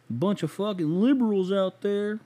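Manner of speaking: a hammed-up Southern accent griping about liberals. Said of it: "that fucking Southern voice".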